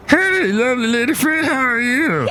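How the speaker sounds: very high put-on greeting; comic visitor. Visitor greeting the co-host, very high character voice. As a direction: greet in a silly, sky-high voice. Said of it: "Hey, look who's here".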